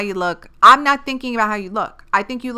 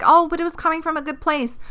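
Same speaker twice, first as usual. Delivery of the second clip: mock excuse; 8 semitones higher than usual. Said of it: "the intent is like".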